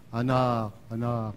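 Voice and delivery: voice of Jesus. Retold or imitated. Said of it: "di ko alam kung anong tono eh".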